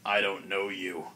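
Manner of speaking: low, soft monster voice; low, brusque creature brush-off; Ogremon. Acted. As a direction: dismiss them in a deep, quiet ogre voice. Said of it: "Ogremon goes".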